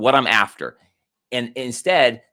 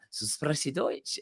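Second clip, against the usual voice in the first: quoted German woman; 5 semitones higher, 10 dB quieter, much slower than usual. Retold or imitated. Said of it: "this lady comes up afterwards, and she says".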